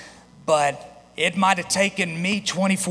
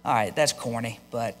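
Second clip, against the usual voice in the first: put-on reluctant kid; 6 semitones lower, 4 dB quieter than usual. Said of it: "you go".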